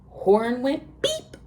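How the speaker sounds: ordinary delivery, nothing marked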